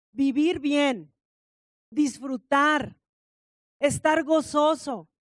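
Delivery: drawn-out slow words, each one weighed; slow, deliberate preaching. Real, not acted. Preacher to congregation, slow and deliberate. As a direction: savour every word, slowly.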